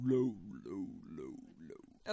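sliding down into a deep, fading low. Shown as a demonstration. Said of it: "Really low, really high".

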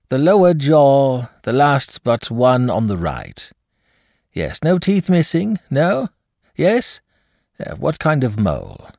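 high, brisk, probing doctor; a coroner's doctor pressing calm questions. Acted. Doctor questions, one side only.

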